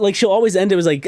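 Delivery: ordinary talk, nothing marked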